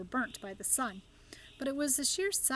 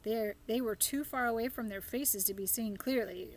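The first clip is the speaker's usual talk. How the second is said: plain reading voice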